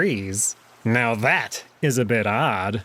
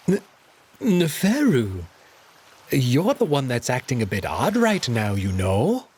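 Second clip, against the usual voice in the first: sly cat voice. Acted. Alex deflecting with a coy sly voice. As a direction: turn it back on him, coyly.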